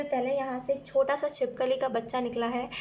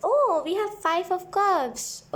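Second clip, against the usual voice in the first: higher, drawn-out "oh" of discovery; surprised reaction; 6 semitones higher, 4 dB louder, much slower than usual. Real, not acted. Tarot reader reacting to the card just drawn, surprised. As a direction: react slowly to a revealed card.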